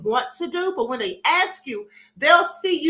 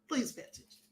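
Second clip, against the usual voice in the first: softer murmured reminder; quiet aside; 3 semitones lower, 15 dB quieter than usual. Real, not acted. Host quietly urges viewers to listen, soft aside. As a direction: slip in a hushed plea. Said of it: "quiet aside to chat".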